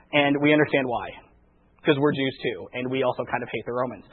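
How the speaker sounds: low, hushed admission; confiding aside. Acted. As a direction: drop right down, as if admitting it privately. Apostles' confiding admission, acted.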